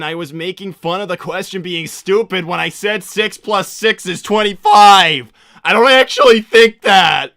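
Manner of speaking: building from loud protest to high, full yell; exasperated shouting. Acted. Sonic defending his math joke to chat, building to exasperated yell.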